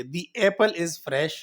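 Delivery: very slow demo, "the" said as long "dee" before "apple". Shown as a demonstration. Teacher models "the" before a vowel sound, very slowly. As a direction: stretch "the apple is fresh", with "the" as "dee". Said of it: "تو اس میں ہم دی پڑھیں گے".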